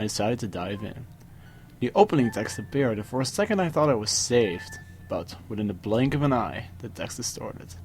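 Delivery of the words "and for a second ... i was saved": plain reading voice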